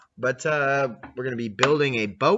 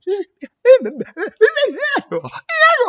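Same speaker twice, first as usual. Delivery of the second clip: very high loud laugh, falling; shrieking laugh at his own joke; 11 dB louder than usual. Real, not acted. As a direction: squeal with laughter.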